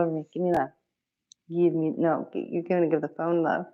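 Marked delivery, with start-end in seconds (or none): none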